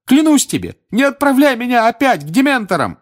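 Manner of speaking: high, loud begging scream; terrified pleading shout. A narrator's reading. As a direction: beg not to be sent back. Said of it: "ещё громче крикнул".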